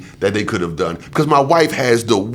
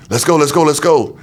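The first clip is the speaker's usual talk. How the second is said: louder, urgent hurry-up calls; urgent calling; 6 semitones higher, 4 dB louder than usual. Retold or imitated. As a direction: shout like medics rushing a gurney. Medics rushing him to surgery.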